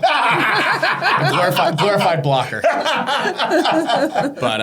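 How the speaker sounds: loud, high laughing words. Real, not acted. Laughing at his own joke.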